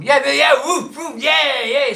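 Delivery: high, loud burst of cheers; excited whooping. Real, not acted. Host looking forward to Craft Republic, excited whooping. Cheering at prospect of pub visit.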